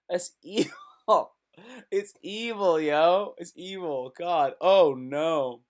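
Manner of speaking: high, laughing "evil"; half-laughing outrage at the comment; laughing disbelief. Real, not acted. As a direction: laugh it out in shock. Laughing at insult.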